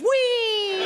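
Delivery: high sustained gleeful "wheee"; long giddy whee. Retold or imitated. Old man flying round the trolley gauntlet, giddy whee. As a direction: hold a high whee all the way. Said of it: "blur of giddy wrinkles".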